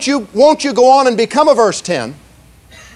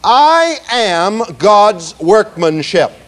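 slow, every word spaced out for the room; slow recitation; much slower than usual. Shown as a demonstration. Preacher leading the congregation in a slow spoken recitation. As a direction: lead the room through it word by word. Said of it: "I want you to say that with me".

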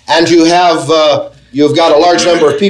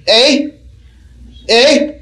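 higher mock "Eh?" of an old man cupping his ear; old person straining to hear; 7 semitones higher than usual. Preacher imitates an old person who can't hear. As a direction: play a hard-of-hearing old man. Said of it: "an older person might say".

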